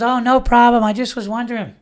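high-pitched mock female voice; imitated woman. Neighbor answering the dog's owner, high imitated woman's voice. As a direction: put on a high voice for the neighbor, loud at first.

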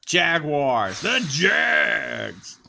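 lightly done sports radio host. Pretend. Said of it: "Are we doing sports radio voice?".

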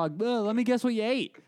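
high, teasing reporter quip; joking news reporter. Retold or imitated. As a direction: needle the guy like a cheesy TV host. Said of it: "And then he would go".

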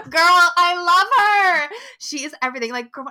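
gushing shout. Real, not acted. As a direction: shout your love for her, stretching it out. Gushing over favourite spider.

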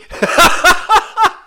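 high, breaking real laugh; high burst of laughter. Real, not acted. The narrator cracking up at the drunk guy's catch, high laugh. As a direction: crack up at the memory.

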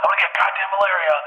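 high-pitched acted panic; freaking out. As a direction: act out freaking out. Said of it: "now I'm freaking out".

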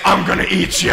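mock monster chasing a child; put-on scary monster voice; playful monster threat. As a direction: threaten like a pretend alien. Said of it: "running after her, saying".

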